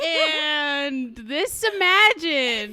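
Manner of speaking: loud, high, drawn-out gushing; real squealing delight. Real, not acted. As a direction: stretch it out, overcome by cuteness. Gushing over the cute story.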